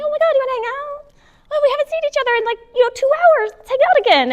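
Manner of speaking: very high, excited, clingy friend; anxious friend. Retold or imitated. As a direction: gush like an overeager friend. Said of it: "that friend that's always texting, like".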